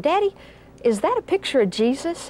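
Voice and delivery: lightly voiced little boy asking; child voice. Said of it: "he said".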